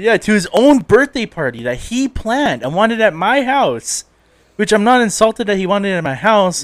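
high, loud, slow emphatic complaint; indignant. Real, not acted. Complaining about friend late to his own party, indignant. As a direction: hammer out each point in exasperation.

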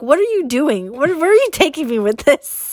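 bewildered. Retold or imitated. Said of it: "I'm like".

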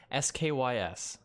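usual speaking voice

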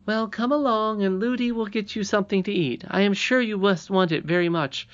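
high, chatty princess voice; hospitable young princess. Acted. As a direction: invite him along as the little princess. Irene invites Curdie.

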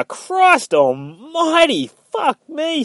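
very high, drawn-out exclamation; exasperated outburst. Retold or imitated. Yorick interrupting Victoria's speech on sins, exasperated outburst. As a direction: cut in with a stretched, high-pitched moan of disbelief.

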